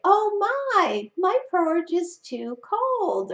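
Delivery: high, fussy storybook mama bear. Shown as a demonstration. Parent reading the three bears to a child, high mama bear voice. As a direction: put on a high mama bear voice for a read-aloud. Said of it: "use the voice of the mama bear".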